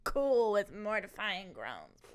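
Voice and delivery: loud, slow Shakespearean declaiming; dramatic monologue. Shown as a demonstration. Actor showing a friend how to perform the monologue, loud. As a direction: project it like on stage. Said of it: "Here's how I would do this one".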